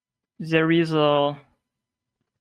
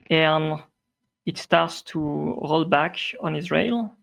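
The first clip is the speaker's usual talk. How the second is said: ordinary talk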